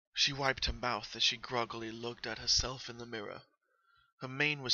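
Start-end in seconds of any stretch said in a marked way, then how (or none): none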